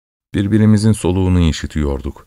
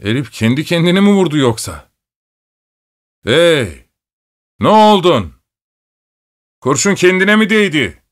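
high-pitched, incredulous questions; startled cries at a raised pitch; astonished shouting; 9 semitones higher than usual. A narrator's reading. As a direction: call out in astonishment, pitch climbing sharply. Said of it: "bu durum karşısında şaşırarak".

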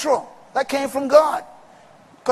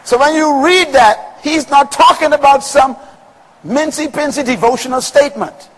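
normal talking voice

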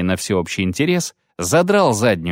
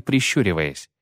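usual speaking voice

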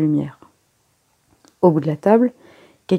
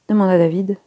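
usual speaking voice

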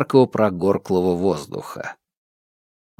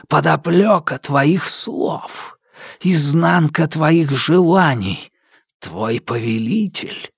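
slow, higher menacing spirit; ominous darkness voice; 3 semitones higher, slower than usual. Acted. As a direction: intone it like a dark spirit. Darkness speaks as a figure.

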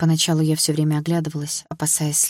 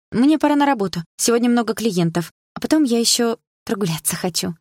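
higher, shy hurried excuse; embarrassed friend; 6 semitones higher than usual. A narrator's reading. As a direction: dodge the question, a little embarrassed. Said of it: "смущённо добавила Дилара". (Дилара смущённо оправдывается)

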